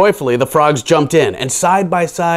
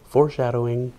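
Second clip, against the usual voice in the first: soft unvoiced aside; whispered aside; 3 semitones lower, 8 dB quieter, faster than usual. Real, not acted. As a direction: lean in and whisper the joke.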